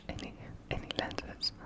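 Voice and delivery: normal talking voice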